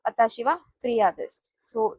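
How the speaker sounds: ordinary talk, nothing marked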